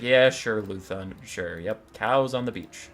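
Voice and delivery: low, unimpressed captain's reply; weary humoring. Acted. Long-suffering captain humors his mad boss, weary deadpan. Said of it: "used to all this stupid shit".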